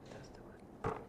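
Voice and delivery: barely voiced, hushed whisper; little whisper. Eliyahu recognising God's voice in the quiet, whispering. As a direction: whisper it very softly. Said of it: "there was just a little whisper".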